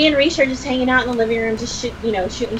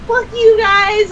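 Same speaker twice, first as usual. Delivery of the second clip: high, slow imitated outrage; retold pissed-off friend; 10 semitones higher, 3 dB louder, slower than usual. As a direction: imitate a furious friend, stretching each word. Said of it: "she was so pissed".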